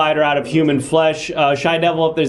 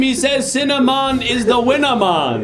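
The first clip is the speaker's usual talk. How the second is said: put-on high-pitched Jamaican-accented insistence; indignant, lilting Jamaican accent; 6 semitones higher than usual. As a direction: quote the slogan in a Jamaican accent. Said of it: "don't have to do the accent".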